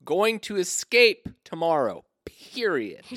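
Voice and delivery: dictating a diary. Retold or imitated. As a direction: read it out like a stiff journal entry. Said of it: "Here we go".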